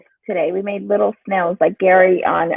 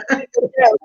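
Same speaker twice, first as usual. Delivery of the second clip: laughing; 5 semitones higher than usual. Real, not acted. Laughs after the joke.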